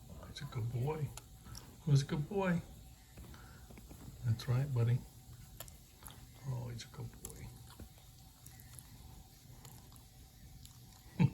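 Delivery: soft, doting pet praise; talking to a dog. Real, not acted.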